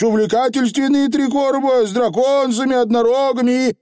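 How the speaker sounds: high, eager patter; coaxing Feegle. A narrator's reading. Feegle tempting the witch with stories, eager. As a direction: talk up a tall tale in dialect.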